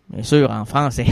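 ordinary delivery

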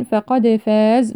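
slow فقد فاز with a bounced d; echoing dal. Shown as a demonstration. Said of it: "نقلقل الدال". (قلقلة دال فقد مع ترتيل بطيء)

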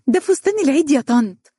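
put-on high, excited child's voice; proud little girl. A narrator's reading. Little girl showing auntie her Eid dress, high and proud. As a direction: show it off like a delighted little girl.

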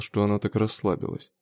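usual speaking voice

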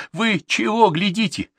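high, indignant demand; rallying helpers. A narrator's reading. Official to his idle helpers, high urgent reproach. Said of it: "призывая помощников".

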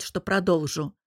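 ordinary delivery, nothing marked

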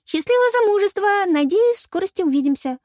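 high, loud goodbye call; excited farewell. A narrator's reading. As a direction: toss off a gushing farewell from the doorway. Veronika calling a hurried goodbye. (восторженно попрощаться)

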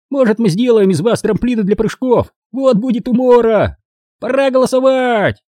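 high, squeaky taunting glee; gleeful gnome. A narrator's reading. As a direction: gloat happily in a high gnome voice. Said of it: "Радостно добавил Фикс". (радостный писклявый гном)

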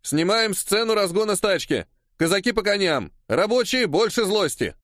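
high, energetic rallying call; megaphone commands. A narrator's reading. Assistant director ordering Cossacks and workers on set, commanding. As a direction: rap out orders to a film crowd. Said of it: "прогремел усиленным мегафоном голос помощника режиссера".

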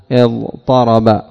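اضطرب said slowly with emphatic ط; slow demo word. Shown as a demonstration. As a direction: draw out اضطرب for the dictionary lookup. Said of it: "تبحث عن مادة".

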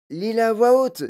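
high, loud request; eager command. A narrator's reading. Grandfather calls for reading aloud.

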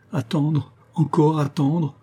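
high, drawn-out moan of protest. A narrator's reading. Poor mother told to wait till sunrise, moaning. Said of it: "gémit la pauvre femme".

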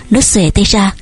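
ordinary delivery

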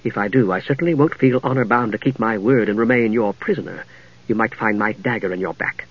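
low, soft threat; quiet, controlled menace; cool. Acted. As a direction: warn him coolly, without raising your voice. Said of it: "Palin said coolly".

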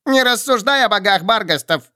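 thin squeaky little voice; squeaky toy. A narrator's reading. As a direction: squeak like a tiny straw creature. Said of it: "пропищал тонкий голосок".

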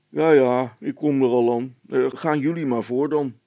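a policeman brushing it off; gruff officer. A narrator's reading. (stoere veldwachter, wuift het weg)

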